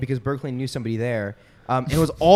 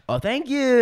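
put-on girl being polite; 10 semitones higher, 3 dB quieter than usual. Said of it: "And she's like".

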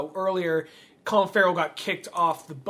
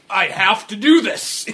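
slow, deliberate mock-robotic delivery; 2 semitones higher, 6 dB louder, slower than usual. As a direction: imitate a man mechanically forcing himself on, louder. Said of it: "Just almost robotically".

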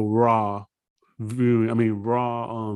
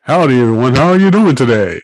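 loud, pitchy pitchman greeting; salesman voice; 11 dB louder, faster than usual. Acted. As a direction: sell it like a car lot salesman. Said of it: "Gotta put on my salesman voice".

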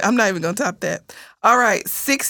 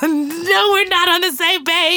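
high-pitched laughing protest; laughing outrage; 9 semitones higher, slower than usual. Real, not acted. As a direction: laugh through mock disbelief. Mock outrage over sugar in grits.

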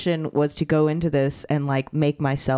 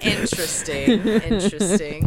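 mock pondering; 4 semitones higher than usual.

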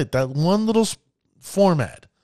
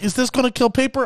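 higher, quick anxious question; worried player; 8 semitones higher, faster than usual. Retold or imitated. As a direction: fret like a nervous collector. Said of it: "Some people are like".

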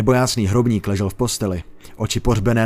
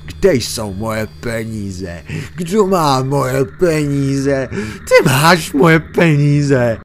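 very slow wail rising higher and louder; ghost building to accusation; 5 semitones higher, 6 dB louder, much slower than usual. Acted. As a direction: drag it out then accuse him. Ghost reaches the climax.

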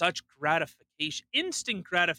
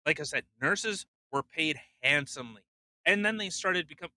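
ordinary delivery

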